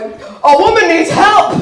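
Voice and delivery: loud, high, drawn-out proclamation; mock superhero. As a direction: announce it like a superhero to the rescue. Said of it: "I felt like a superhero low-key".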